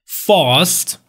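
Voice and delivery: British "Fast" with a long ah; British accent, open back vowel in "Fast"; British pronunciation. Shown as a demonstration. English teacher showing the British vowel, British accent. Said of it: "بریتیش".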